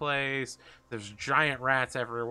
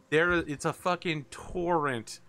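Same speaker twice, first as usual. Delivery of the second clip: ordinary delivery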